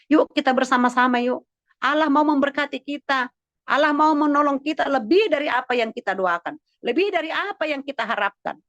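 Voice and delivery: high-pitched, rallying preacher voice; fervent exhortation. Real, not acted. Pastor rallying congregation that God will bless, fervent exhortation. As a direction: urge the flock on with conviction.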